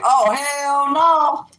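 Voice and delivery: high, loud, slowly stretched outcry; horrified refusal. Retold or imitated. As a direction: drag out the horror, way up high. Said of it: "I'm like".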